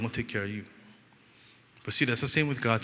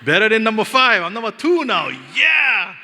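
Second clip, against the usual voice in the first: high, loud victorious boast; triumphant cheer; 10 semitones higher, 11 dB louder than usual. Real, not acted. Uncle boasting of his new rank to the crowd, loud triumphant cheer. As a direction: shout it out in triumph, ending on a big yeah.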